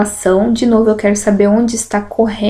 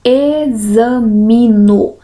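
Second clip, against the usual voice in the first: very slow, E set apart: E-xa-mi-no; syllable by syllable; much slower than usual. Shown as a demonstration. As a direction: sound out Examino slowly, syllable by syllable. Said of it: "tem a palavra examino".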